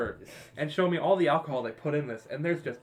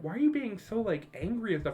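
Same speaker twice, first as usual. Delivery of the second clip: higher mom voice; 6 semitones higher than usual. Retold or imitated.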